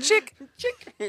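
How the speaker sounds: silly high voice. Pretend.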